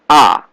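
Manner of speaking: A falling steeply; fourth tone. Shown as a demonstration. Teacher reading the tone table, falling A. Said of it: "Fourth tone is going down".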